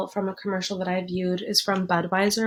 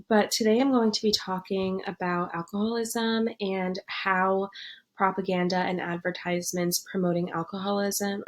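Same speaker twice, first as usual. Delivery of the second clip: normal talking voice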